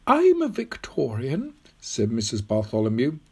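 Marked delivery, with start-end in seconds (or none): 0.1-1.5 put-on high, slow old lady's voice; old woman, assured. Old woman explaining her age to Tom, assured and high. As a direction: declare it high and deliberately.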